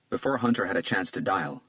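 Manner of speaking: ordinary delivery, nothing marked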